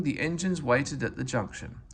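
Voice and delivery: ordinary delivery, nothing marked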